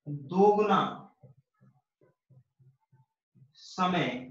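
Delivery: "दो मात्रा या दोगुना समय लगता हो" spoken slowly, word by word with long pauses; slow dictation of "दो मात्रा या दोगुना समय लगता हो". Shown as a demonstration. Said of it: "वो सुन लो सर".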